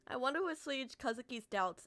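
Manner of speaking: ordinary delivery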